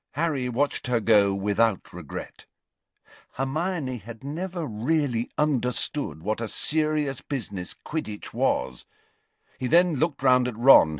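usual speaking voice

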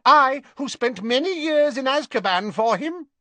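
high, loud zealous witch; proud and fanatical; Bellatrix voice. A narrator's reading. Bellatrix boasting.

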